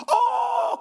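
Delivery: high strained gasp; a moved, choking outcry; choked cry. Real, not acted. Pastor overwhelmed by the debtors' plight, choked cry.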